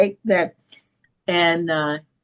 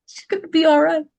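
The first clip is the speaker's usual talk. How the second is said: laughing; 11 semitones higher, 5 dB louder, faster than usual. Real, not acted. Retelling her daughter's relief, laughing higher and louder.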